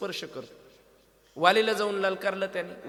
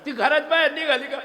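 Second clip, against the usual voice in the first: much higher, louder, laughing through the words; 9 semitones higher, 11 dB louder than usual. Real, not acted. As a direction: crack up while telling it. Laughing climax of comic story. (हसत हसत, खूप मोठ्या आवाजात)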